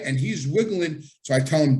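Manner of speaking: ordinary delivery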